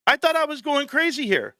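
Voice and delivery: high, eager thanks to the chat; thrilled the chat backs him up; relieved excitement. Real, not acted. Viewers confirm he was right.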